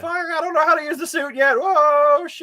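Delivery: high, loud, panicked cursing; panicked hero. Acted. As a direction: voice a falling hero losing control. Voicing the hero while he falls.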